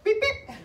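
high, piercing double beep; shrill mouth-made beep-beep; imitated walkie-talkie beep. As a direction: mimic a radio call tone in two squeaky chirps. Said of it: "this thing fucking beeps every time".

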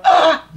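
a high, loud, laughing "Ah!" of surprise; surprised gasp. Retold or imitated. Teacher imitating students startled by the changed picture, laughing gasp. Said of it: "some of you might have just gone".

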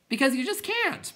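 high, loud exasperated shout; screamed protest. A narrator's reading. Bastian answering the clowns, high scream. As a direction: scream it up at them. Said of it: "Bastian screamed".

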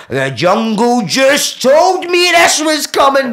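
slow, high, very loud yell; inner scream. Retold or imitated. Screaming inside at the phone offer, high drawn-out shout. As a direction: yell it drawn out, wildly overwrought. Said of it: "obviously in my head".

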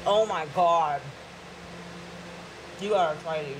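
ordinary delivery

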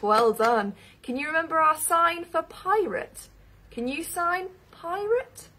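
ordinary delivery

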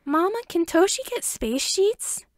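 put-on high, slow little boy asking; sweet little kid voice; child voice. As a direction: ask like an eager small child.

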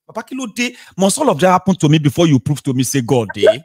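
exasperated retort. Retold or imitated. Caller retells his reply to preachy friends, loud exasperated disbelief. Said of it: "You say".

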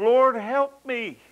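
high, slow, pleading cry; desperate plea. Retold or imitated. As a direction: beg for help, drawn out. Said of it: "the pathos in her voice, and she's crying out in desperation".

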